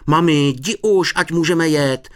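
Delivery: high, loud, stretched-out plea; a boy pushing mum to hurry; impatient urging. A narrator's reading. Honzík hurries mum off the train, impatient.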